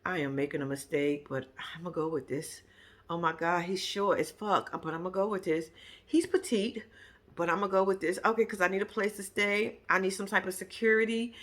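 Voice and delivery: low, quiet reluctant muttering; hushed, imitated inner monologue of a reluctant bride; resigned inner thought. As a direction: talk yourself into it under your breath. Acts out bride's reluctant private thoughts.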